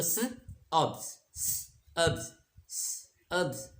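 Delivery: स and अब्स, airy and split apart; syllables drawn out for students; sounded out. Shown as a demonstration. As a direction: break सब्स into स and अब्स. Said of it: "सब्स".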